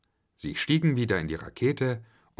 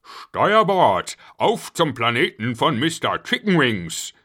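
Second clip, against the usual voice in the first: loud, high shouted command; rousing captain's call; 5 semitones higher, 7 dB louder than usual. A narrator's reading. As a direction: bellow an order to the crew. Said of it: "Der Kapitän rief".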